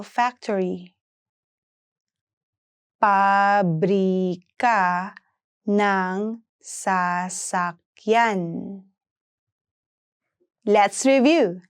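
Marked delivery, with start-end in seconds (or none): 3.0-8.4 "Pabrika ng sasakyan" in long, separate syllables; slow pronunciation model. Shown as a demonstration. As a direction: stretch it out for learners to follow. Teacher breaks phrase down slowly.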